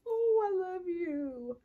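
teary tender gush; tearful. Real, not acted. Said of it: "I am really about to cry".

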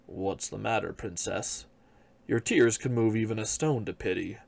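low, gentle frog voice calling up from the well. Acted. Frog at the well comforting the crying princess, low frog voice. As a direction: voice the frog, pitched low and kind.

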